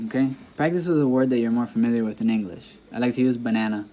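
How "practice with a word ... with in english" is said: usual speaking voice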